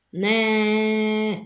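long, high held cry; drawn-out, high-pitched refusal; crowd shriek. A narrator's reading. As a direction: stretch it out like a screaming mob. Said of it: "rikoltozta a tömeg".

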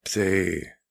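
hoarse, rasping cry. Acted. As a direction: rasp it like crackling wood. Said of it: "Его голос был хриплым".

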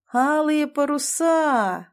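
very slow, high, stretched-out vowels; lazy drawl. A narrator's reading. Crewmate musing on the posh housing complex's name, lazy drawl. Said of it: "лениво протянул Степан".

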